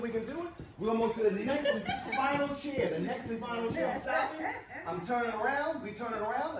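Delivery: keyed-up guide calling out each move; excited. Real, not acted. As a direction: hurry a partner along with excited encouragement. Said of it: "your voice was a little more excited".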